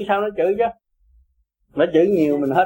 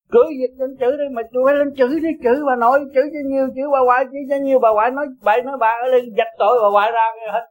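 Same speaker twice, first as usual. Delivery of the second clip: higher-pitched, insistent goading; mock dare; 7 semitones higher, faster than usual. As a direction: playfully invite them to curse away. Dares children to curse him. (thách thức, giọng cao dồn dập)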